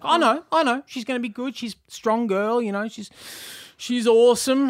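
mock tearful man pulling himself together; steeling himself. A father on the bus braces himself, high and strained. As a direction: fight back the tears with a forced brave voice. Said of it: "sort of steeling himself up again".

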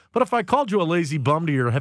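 ordinary delivery

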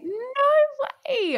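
very high, drawn-out squeal of disbelief; real excited squeal, stretched out long; voice shooting up. Real, not acted. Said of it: "My voice just went up".

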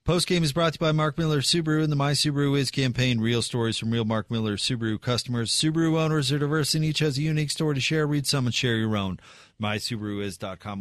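polished sponsor read, upbeat. Acted. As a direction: read it like a radio spot.

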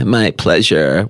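low, creaky, drawn-out "my pleasure"; fry through "my pleasure" slowly; vocal fry. Retold or imitated. Repeating the service phrase, a low creaky vocal fry. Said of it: "With the vocal fry".

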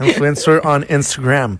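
slow, exaggerated English accent. Shown as a demonstration. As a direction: Influencer on Instagram said like an anglophone. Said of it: "dis-le avec un accent anglais". (accent anglais exagéré)

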